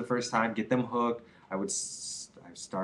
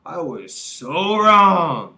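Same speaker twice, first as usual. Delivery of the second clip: high, drawn-out admission; mock sheepish apology; 6 semitones higher, 5 dB louder, slower than usual. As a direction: sheepishly own up, stretched. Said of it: "you would probably admit".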